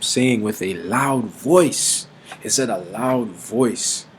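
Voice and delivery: "loud voice" drawn out slowly with weight; stress on loud voice. Shown as a demonstration. Preacher stressing the words for his listeners, slow emphasis. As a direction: lean hard on "loud voice". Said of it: "that's emphasis on that".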